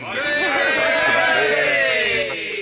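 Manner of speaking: high, loud mock battle cry; Viking cry. Presenter hailing a Viking queen, mock war cry. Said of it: "The Viking cry of the Queen".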